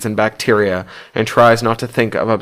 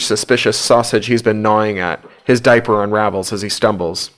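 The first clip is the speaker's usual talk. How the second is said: ordinary delivery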